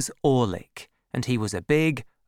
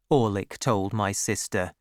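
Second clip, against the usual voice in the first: ordinary delivery, nothing marked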